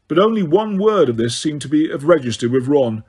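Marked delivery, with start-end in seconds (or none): none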